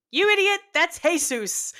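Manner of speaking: high, slow scolding mom voice; exasperated mom. Retold or imitated. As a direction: scold like a fed-up mother. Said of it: "my mom was like".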